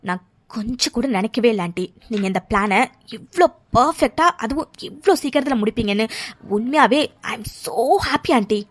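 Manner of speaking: ordinary delivery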